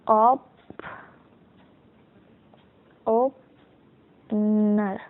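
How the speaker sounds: Opener in slow pieces, long gap before नर; sounding out. Shown as a demonstration. Teacher gives opener as shorthand example, sounding it out slowly. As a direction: stretch opener out as if writing along. Sounding out word while writing it.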